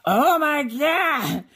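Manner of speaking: high, long-drawn exasperation; exasperated groan. Retold or imitated. Recorder interrupted by sister's call, exasperated groan. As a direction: drag it out as if fed up.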